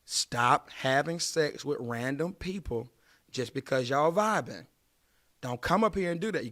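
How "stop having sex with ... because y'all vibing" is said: slow, deliberate plea; slow earnest warning. Real, not acted. Pleading with viewers, slow and earnest. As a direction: lay each word down slowly. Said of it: "I'm trying to tell y'all".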